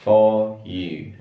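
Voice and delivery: "for you" with no R, British style; silent R before consonant. Shown as a demonstration. Teacher showing students a silent British R, careful. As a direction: drop the R in "for", say "fo you". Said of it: "R akan silence ketika ketemu consonant".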